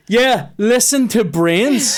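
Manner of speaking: sarcastic slow mock echo. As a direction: drag it out mocking him back. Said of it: "And I went".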